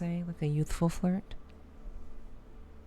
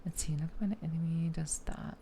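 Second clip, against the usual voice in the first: whispering; 7 dB quieter, faster than usual. Real, not acted. Whispered to self in game.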